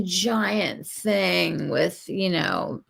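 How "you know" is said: usual speaking voice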